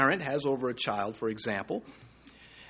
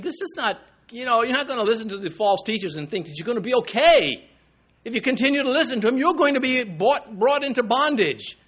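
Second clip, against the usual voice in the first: fervent preaching; 6 semitones higher than usual. Acted. Preaching Peter's warning at force.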